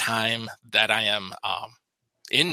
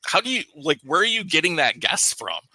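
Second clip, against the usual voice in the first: higher-pitched retold bafflement; incredulous questioning; 6 semitones higher than usual. Challenging the man at the bar over his guess, incredulous. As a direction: replay challenging someone's absurd guess, pitched up.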